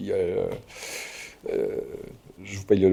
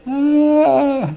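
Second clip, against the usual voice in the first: high, dreamy inner thought; musing as if won over; much slower than usual. Retold or imitated. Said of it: "puis tu te dis". (pensée intérieure rêveuse)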